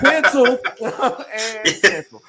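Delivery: laughing. Real, not acted. Joking about Depp getting cancelled.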